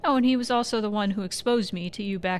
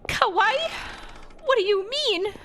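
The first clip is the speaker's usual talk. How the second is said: shocked squeal; 13 semitones higher than usual. Acted. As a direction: squeak it out, flustered. Flustered at being called cute.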